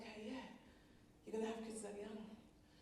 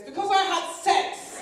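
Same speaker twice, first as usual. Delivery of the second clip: loud, higher, slow shouted confession; 7 semitones higher, 11 dB louder, slower than usual. Pretend. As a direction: yell it down the stairs. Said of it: "wanted to shout downstairs".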